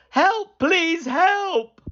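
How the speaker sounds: high, loud desperate call; high, loud terrified cry; frightened plea. Acted. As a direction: plead in terror. Terrified boy pleads.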